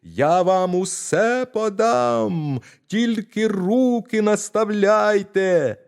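put-on high, loud tiny-boy yell, slow and stretched; yelling as loud as he can. Tiny boy yells to the thieves to hold out their hands, high and loud. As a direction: yell with all the voice he has. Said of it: "знову закричав, скільки було голосу".

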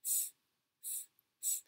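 "z" whispered, no voice, so it hisses like "s". Shown as a demonstration. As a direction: breathe "z" as if you lost your voice. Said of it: "Pretend you've lost your voice".

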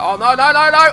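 alarmed cry. Real, not acted. Crashing the police car, rising alarmed cries. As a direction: panic building with every no.

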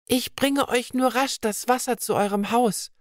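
a hint of a shy girl; young maiden. Vibeka offers to bring water, lightly girlish. Vibeka voiced speaking to woman.

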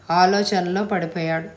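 usual speaking voice

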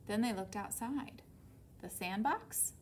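usual speaking voice

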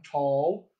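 TALL in General American, opener, looser 'aw'; General American accent. Shown as a demonstration. Coach contrasting thought vowels, General American accent on TALL. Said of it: "versus the Gen M".

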